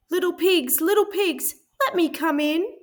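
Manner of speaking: high, polite pleading wolf voice. A narrator's reading. Wolf politely asks the pig to let him in, high and sweet. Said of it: "He knocked politely on the door and said".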